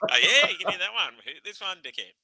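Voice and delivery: high, laughing mock taunt; taunting sledge. Bowler sledging the batter after the beamer, in a high laughing taunt. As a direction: gleefully jeer at the batter. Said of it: "That's where the beamer come out".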